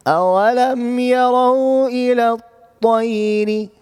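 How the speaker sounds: tajweed recitation. Shown as a demonstration. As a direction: intone the verse like a reciter. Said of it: "teacher recites verse".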